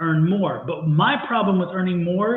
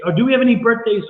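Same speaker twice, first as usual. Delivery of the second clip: calling out; 5 semitones higher, 6 dB louder, faster than usual. Real, not acted. Calling out to audience.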